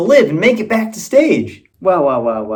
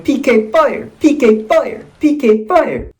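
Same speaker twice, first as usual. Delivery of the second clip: high-pitched mimicked game character call; game attack catchphrase; 7 semitones higher, slower than usual. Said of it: "beat Lars by going".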